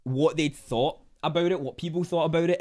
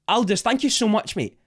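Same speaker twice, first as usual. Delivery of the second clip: high, loud grateful burst; excited thanks; 7 semitones higher, 5 dB louder than usual. Real, not acted. Streamer thanking Aldous for a donation, excited. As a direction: thank them with a raised, delighted voice.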